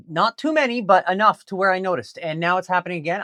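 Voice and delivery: usual speaking voice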